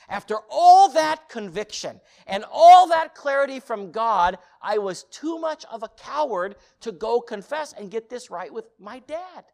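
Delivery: loud, high, full-force confession; impassioned preaching. Real, not acted. Preacher admitting cowardice to congregation, impassioned loud preaching. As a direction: hammer out the admission.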